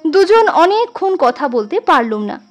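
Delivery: ordinary delivery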